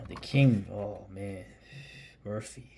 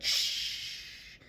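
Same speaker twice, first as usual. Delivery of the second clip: long, voiceless "shh" hiss; imitated can hiss; 5 dB quieter than usual. Showing viewers how the can sounds when opened, imitated hiss. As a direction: make a drawn-out fizzing shush.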